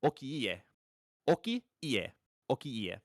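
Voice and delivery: Okiie said as oh-kee-ee-eh, every vowel sounded; careful Japanese reading of Okiie; Japanese name Okiie. Shown as a demonstration. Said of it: "This pronunciation is".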